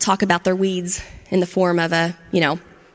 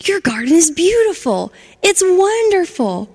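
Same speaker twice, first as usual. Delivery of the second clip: higher, louder happy call, stretched out; joyful shout; 9 semitones higher, 3 dB louder than usual. Acted. Charlie shouting happily to gardens he passes. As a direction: call out to the neighbours with joy. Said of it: "he shouted".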